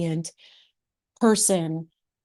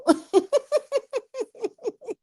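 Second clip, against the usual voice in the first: real high-pitched burst of laughter; a squealing fit of laughter. Real, not acted. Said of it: "I'm not laughing at you".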